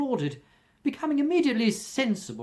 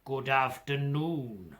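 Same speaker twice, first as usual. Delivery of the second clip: curt dismissal; 3 dB quieter, slower than usual. Acted. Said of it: "said Scrooge".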